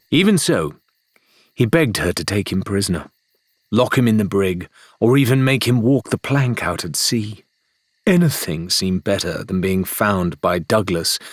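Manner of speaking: ordinary delivery, nothing marked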